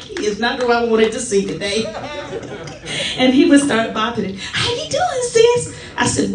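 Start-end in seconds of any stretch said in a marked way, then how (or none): 0.0-3.2 laughing. Retold or imitated. Dreading a visitor, laughing. 4.5-5.7 much higher, bright singsong greeting; mimicking cheery greeting. Elder bouncing into a hospital room, much higher cheery singsong.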